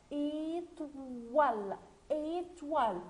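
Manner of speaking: ÉTOILE slowly, with OI as "wa"; teacher modelling ÉTOILE, long first vowel; sounded-out word. Shown as a demonstration. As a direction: stretch ÉTOILE into é-twal. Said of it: "O plus I se prononce wa".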